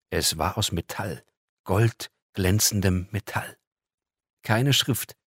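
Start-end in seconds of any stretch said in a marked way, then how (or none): none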